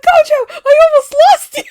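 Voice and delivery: high, loud squealed reunion cry; excited yell. Retold or imitated. Reunited with the lost photo card, squealing yell. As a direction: wail it fast and high, breaking into laughter. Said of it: "I just yelled".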